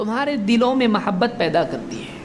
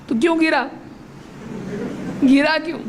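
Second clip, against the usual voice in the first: higher-pitched challenging question; raised-pitch squabbling retort; mock confrontation; 6 semitones higher, faster than usual. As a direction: throw it back like someone picking a petty quarrel. Acted quarrel after a fall.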